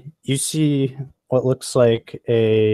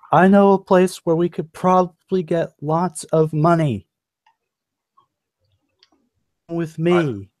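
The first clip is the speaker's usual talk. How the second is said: higher-pitched announcement to a room; rally the crowd with a raised pitch; calling out; 7 semitones higher, 3 dB louder than usual. Acted. NPC hailing the whole room.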